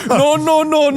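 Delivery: put-on high, loud West African accent. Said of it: "my African accent".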